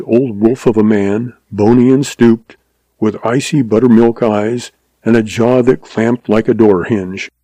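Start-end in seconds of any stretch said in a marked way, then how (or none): none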